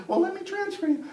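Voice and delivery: high-pitched phone operator voice; lady operator. Acted. Emergency operator answers kid's call, high lady voice. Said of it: "The lady answers".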